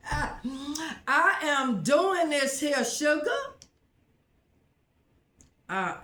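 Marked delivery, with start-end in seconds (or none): 0.1-3.3 sassy. Real, not acted. Sassy bragging to viewers.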